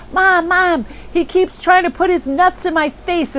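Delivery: put-on a small girl tattling breathlessly to her mom; little girl voice.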